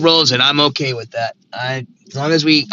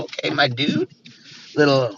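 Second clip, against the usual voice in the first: ordinary talk, nothing marked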